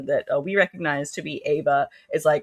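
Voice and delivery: usual speaking voice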